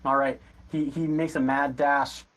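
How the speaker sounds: ordinary talk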